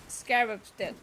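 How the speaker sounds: ordinary delivery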